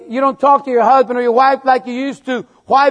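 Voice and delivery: ordinary delivery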